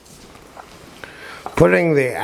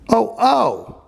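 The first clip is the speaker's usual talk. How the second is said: mock little voice; 11 semitones higher than usual. A narrator's reading. Quoting the little voice.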